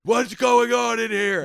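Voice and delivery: high, loud, drawn-out panicked holler; mock an old man freaking out; losing it. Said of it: "this guy's losing his shit".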